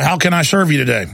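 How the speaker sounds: ordinary talk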